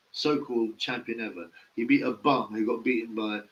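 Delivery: ordinary talk, nothing marked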